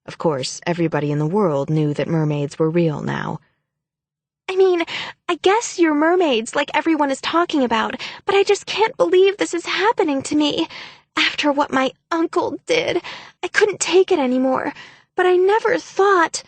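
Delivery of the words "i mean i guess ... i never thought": panicked cry. Acted. Frantic fake story to mermaids.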